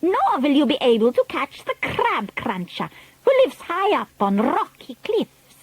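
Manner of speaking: high, imperious witch voice; Grand High Witch. A narrator's reading. Grand High Witch addressing the ancient witches, imperious. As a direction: address the old witches with disdain.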